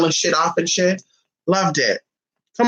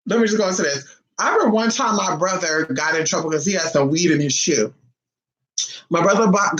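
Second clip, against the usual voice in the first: ordinary talk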